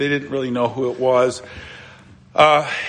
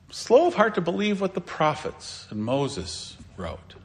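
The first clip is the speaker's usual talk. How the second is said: ordinary talk, nothing marked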